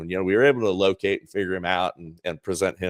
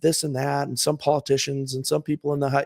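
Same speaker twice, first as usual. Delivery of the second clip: mock young woman; 7 semitones higher than usual. PR woman voiced, retold.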